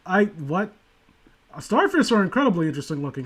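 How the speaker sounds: ordinary delivery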